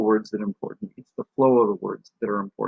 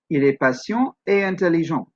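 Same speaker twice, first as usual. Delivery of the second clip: higher "Il est patient et intelligent", up at "patient", falling at the end; French sentence lifted mid-way, falling on "intelligent"; multi-part intonation; 5 semitones higher than usual. Shown as a demonstration. Teacher giving an example, "patient" up, "intelligent" falling. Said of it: "Sometimes they have more parts to them".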